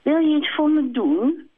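the hen sweet-talking the hare; sweetest little voice. Acted. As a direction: wheedle him sweetly. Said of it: "met haar liefste stemmetje".

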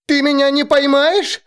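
high shriek of disbelief. Acted. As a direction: shriek it in panic. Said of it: "завизжала Алекс".

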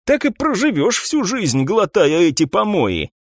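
grumbling. A narrator's reading. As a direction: complain in an exasperated grumble. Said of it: "Коул ворчал и продолжал пить". (раздражённое ворчание)

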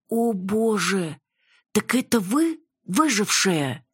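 high, drawn-out astonishment; amazed nurse. A narrator's reading. Nurse realizes she faces the survivor, amazed. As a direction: gasp it out slowly, pitched high.

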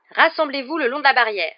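loud, high call; shouted order. A narrator's reading. Hagrid gathering pupils along the fence, shouting. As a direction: shout it out to a group. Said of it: "cria Hagrid".

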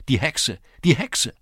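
high, loud cry of alarm; calling out. A narrator's reading. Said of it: "rief zum Hause hin". (laut und hoch rufen)

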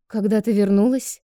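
loud call down the hall. A narrator's reading. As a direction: call out to her. Calling to daughter.

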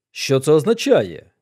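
loud, demanding question; exclaiming. A narrator's reading. Colonel demands the meaning of the telegram, exclaiming. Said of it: "вигукнув полковник".

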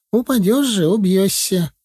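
high, scolding country woman; fretting village woman. Acted. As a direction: warn him in a high worried voice. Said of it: "вклинилась колхозница лет пятидесяти". (колхозница причитает высоко)

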